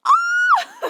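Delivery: sudden high startled shout breaking off the sentence; startled cry. Real, not acted. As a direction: jump and cry out, caught off guard. Startled mid-sentence by something.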